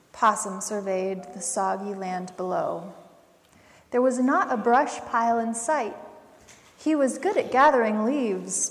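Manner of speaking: ordinary delivery, nothing marked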